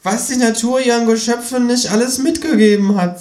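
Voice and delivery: high, marvelling kangaroo voice; high-pitched kangaroo pontificating; opinionated kangaroo. Acted. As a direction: hold forth in a high character voice. Kangaroo figure speaking in story.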